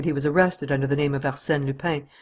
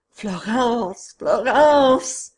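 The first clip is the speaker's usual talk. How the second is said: high, desperate cries building louder; calling out; 8 semitones higher, 4 dB louder, much slower than usual. Acted. Don Luis cries out for Florence, getting louder. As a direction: cry the name out in despair.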